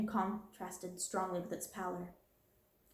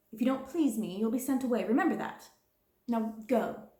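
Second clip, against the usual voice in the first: louder, stern warning; harsh dismissal; 2 semitones higher, 4 dB louder than usual. Acted. Stern threat. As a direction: headmistress threatening the girl and sending her off, harsh.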